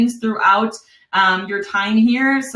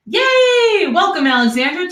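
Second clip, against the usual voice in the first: excited cheer; 9 semitones higher, 3 dB louder than usual. Real, not acted. Celebrating a new sign-up.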